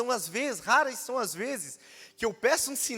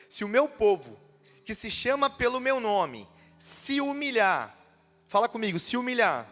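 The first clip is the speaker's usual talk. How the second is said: preached scripture reading; 5 dB louder than usual. Acted. Preacher reading the verse aloud to the church, proclaiming loudly.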